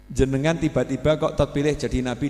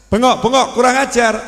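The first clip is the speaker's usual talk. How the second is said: mock rude shout, higher and louder; rude yelling; 10 semitones higher, 8 dB louder than usual. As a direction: bark it out like a disrespectful yeller. Acting someone yelling rudely.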